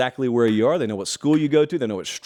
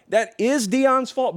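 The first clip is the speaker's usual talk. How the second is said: mock accusation; 11 semitones higher, slower than usual. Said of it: "but it's not Deion's fault".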